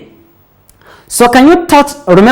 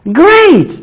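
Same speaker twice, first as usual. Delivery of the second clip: high bright praise; teacher praise; 9 semitones higher than usual. Real, not acted. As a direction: enthusiastic classroom praise. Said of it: "praising child".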